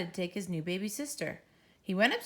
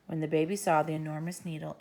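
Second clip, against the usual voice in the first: ordinary delivery, nothing marked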